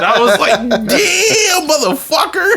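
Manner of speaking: high, laughing shout. Real, not acted. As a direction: yelp the curse through a fit of laughter.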